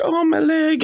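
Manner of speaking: high-pitched wailing goblin; faking injury. Acted. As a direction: whine in a very high put-on voice, playing hurt. Goblin fakes a hurt leg.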